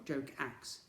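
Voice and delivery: ordinary delivery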